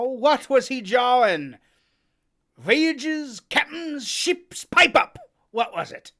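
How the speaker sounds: loud, mocking, rising sailor; Silver berating. Silver badgers Morgan, loud and mocking. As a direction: needle him into talking.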